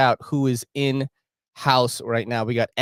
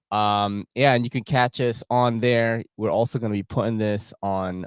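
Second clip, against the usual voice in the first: normal talking voice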